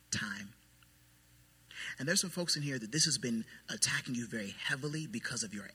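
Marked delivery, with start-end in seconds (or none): 0.1-0.5 whispered word. Acted. Naming what makes it worse.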